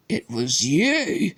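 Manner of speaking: very slow, dawning accusation; Harry confronting the elf, slowly. A narrator's reading. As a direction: let the realization sink in. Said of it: "he said slowly".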